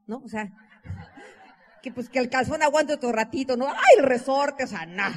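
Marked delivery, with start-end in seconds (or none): 3.7-4.6 mock dismay. Mock dismay over underwear elastic.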